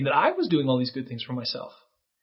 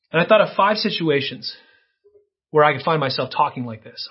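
ordinary delivery

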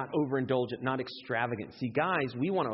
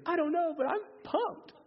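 very high, over-excited squeal; hammed-up clueless excitement, way up high; pumped up. Fired-up guy answering the sober-minded man, comically excited. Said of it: "when I'm fired up".

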